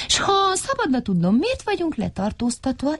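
high, polite query; high-pitched inquisitive little fellow; inquiring character. A narrator's reading. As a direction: ask curiously in a high voice. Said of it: "érdeklődött Mikka Makka".

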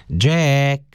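high, drawn-out call of a name; sustained high wake-up call. A narrator's reading. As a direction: call to a dozing man. Girl calls sleeping man again.

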